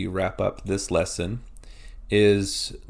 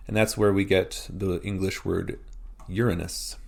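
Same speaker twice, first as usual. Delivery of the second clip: ordinary delivery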